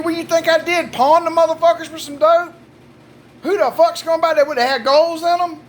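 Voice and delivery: high-pitched, indignant protest; mock outrage. Husband fakes outrage at wife's accusation.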